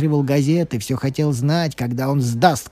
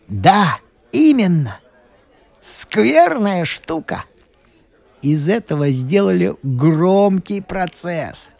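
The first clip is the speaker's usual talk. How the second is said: gleeful; 7 semitones higher than usual. A narrator's reading. Brisher relishes the old man's trial.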